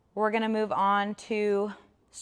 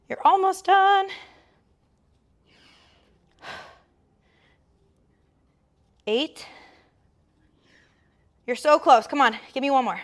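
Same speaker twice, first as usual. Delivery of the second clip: energetic coaching shout; 6 semitones higher, 7 dB louder than usual. Real, not acted. Coach urging final reps.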